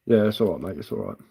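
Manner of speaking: ordinary delivery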